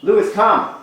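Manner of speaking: loud high call to a dog. Shown as a demonstration. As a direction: call the dog across the yard. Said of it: "let's try it on our dogs".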